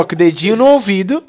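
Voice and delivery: ordinary talk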